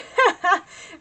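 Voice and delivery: high, loud laugh at her own joke; a sudden bright laugh; real laughter breaking out; burst of laughter. Real, not acted.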